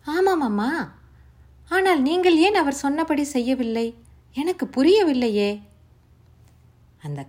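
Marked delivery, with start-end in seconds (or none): 0.1-5.5 put-on much higher, louder bewildered girl; a girl who cannot understand, pleading; puzzled young Savitri. A narrator's reading. Savitri begging to know why, high and bewildered.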